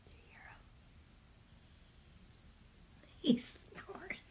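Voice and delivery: soft whispered aside. Real, not acted. Aside to viewers while the dog sleeps nearby, whispering. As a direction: whisper so the dog stays asleep.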